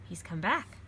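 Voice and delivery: usual speaking voice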